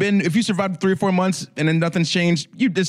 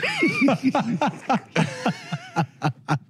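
long helpless laugh, high; high laughing fit; 11 semitones higher, 3 dB quieter than usual. Real, not acted. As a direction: collapse into giggles. Breaks up reading the question.

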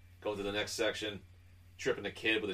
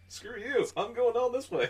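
cheeky goose; 14 semitones higher, 4 dB louder than usual. Retold or imitated. Voicing the goose's taunt.

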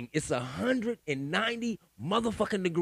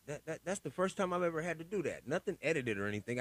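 normal talking voice

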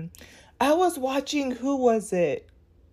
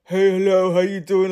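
lower, mildly deepened casual greeting; imitating a bass voice; 4 semitones lower than usual. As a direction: lightly put on a man's deep voice saying hello. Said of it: "it had bass in his voice".

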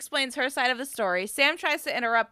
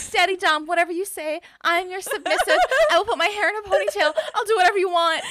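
giggling, higher and fast; laughing mock submission; 6 semitones higher, faster than usual. Co-host mocking obedience to Freddie, laughing and higher. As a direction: play the eager submissive through laughter.

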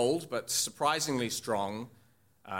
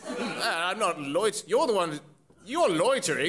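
higher, sputtering character voice; indignant old groundskeeper; 5 semitones higher, 3 dB louder than usual. Acted. Groundskeeper accused of loitering, high indignant voice. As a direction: throw the accusation right back.

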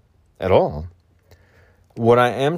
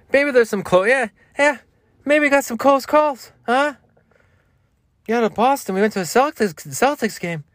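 very high, strained put-on voice; high comic impression; 14 semitones higher than usual. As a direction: chatter way up high like a parody. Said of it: "I haven't been practicing as much".

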